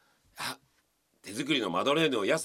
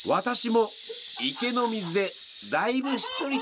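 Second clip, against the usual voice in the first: polite madeleine voice; 5 semitones higher, slower than usual. Acted. Reread punchline.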